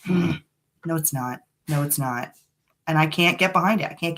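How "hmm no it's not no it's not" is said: lower, softer, flat let-down no; deflated thumbs-down; unimpressed verdict. Retold or imitated. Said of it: "I'm like".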